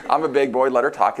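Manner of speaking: ordinary talk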